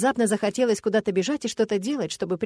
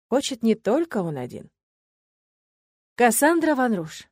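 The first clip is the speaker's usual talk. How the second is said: ordinary delivery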